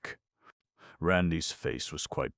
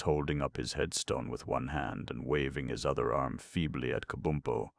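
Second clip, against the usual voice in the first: plain reading voice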